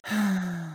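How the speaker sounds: quiet weary sigh; a faint resigned sigh; soft sigh. Acted. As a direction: let out a tired sigh. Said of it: "then sighs".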